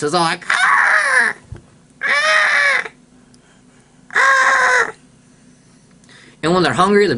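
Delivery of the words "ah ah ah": very high screeching cry; eagle screech. Retold or imitated. Kid imitating a bald eagle, high screech. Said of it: "it's more of a screech noise".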